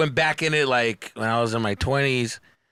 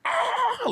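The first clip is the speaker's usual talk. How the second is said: stifled laugh squeal. Retold or imitated. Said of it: "laughing in my fucking shirt".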